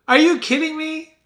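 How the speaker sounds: high, loud mock disbelief; imitated incredulous outburst; mock amazement. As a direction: exclaim it high like an amazed onlooker. Acts out amazed stock reactions, twice.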